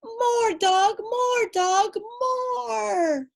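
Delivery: high loud gleeful cry; cried out. A narrator's reading. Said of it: "cried the baby".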